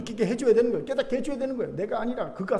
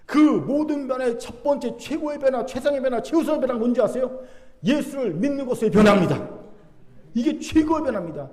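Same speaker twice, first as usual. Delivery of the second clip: higher, emphatic sermon proclamation; full-force preaching; 6 semitones higher, 5 dB louder than usual. Real, not acted. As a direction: proclaim it to the congregation, surging louder. Preacher proclaims the greatest change. (높고 힘주어 선포하는 설교 말투)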